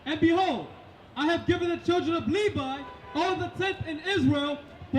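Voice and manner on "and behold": ordinary talk, nothing marked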